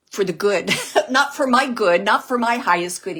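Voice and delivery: laughing, pushing "not" up loud. Retold or imitated. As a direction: laugh it out and hit each "not". Laughing while relaying father's words.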